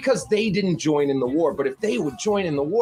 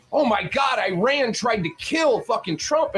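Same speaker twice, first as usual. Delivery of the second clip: higher, hammed-up shocked outcry; mock alarmed media; 7 semitones higher than usual. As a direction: fake alarm like a breathless newscaster. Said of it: "they were like".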